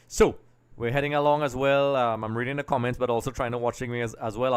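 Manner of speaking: usual speaking voice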